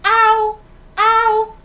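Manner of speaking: loud, high "au, au" like a barking dog; playful loud dog bark "au". Retold or imitated. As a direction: bark it out for the children. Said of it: "Ele faz".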